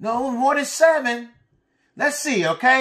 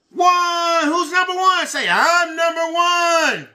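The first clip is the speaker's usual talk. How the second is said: cheering; 5 semitones higher, slower than usual. Acted. Cheering the children on.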